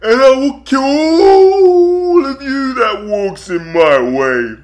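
loud, slow villain threat; Bane voice. Retold or imitated. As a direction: menace them slowly like Bane. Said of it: "It's Bane".

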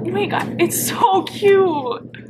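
breathless, then high and slow with emotion; moved almost to tears, stretched-out words; touched, near tears. Real, not acted. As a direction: melt over the gift. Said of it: "I'm gonna cry".

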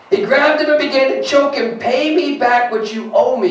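full-force preaching. Acted. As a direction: lift the scripture with pulpit energy.

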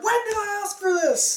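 high, loud put-on cartoon voice; mock character voice. Quoting a movie character, high mock voice. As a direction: squeak out the character's complaint. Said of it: "They're like".

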